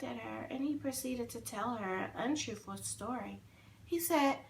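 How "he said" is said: ordinary delivery, nothing marked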